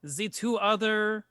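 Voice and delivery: put-on a courteous host introducing himself and his boy; lightly voiced formal innkeeper; innkeeper Nino.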